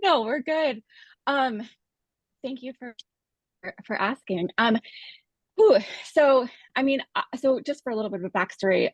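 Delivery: breathless while walking; out of breath. Real, not acted. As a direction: talk between breaths on a treadmill. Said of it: "so I'm a little bit out of breath".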